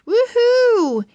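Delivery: high, loud woohoo; excited cheer. Acted. Pug celebrates Friday, excited. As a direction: cheer for the weekend.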